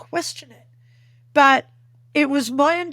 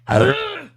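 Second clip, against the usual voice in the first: loud, higher exasperated grunt; frustrated groan; 9 semitones higher, 6 dB louder than usual. Retold or imitated. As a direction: huff out sheer frustration. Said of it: "'cause she's so frustrated".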